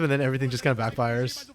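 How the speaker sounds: ordinary delivery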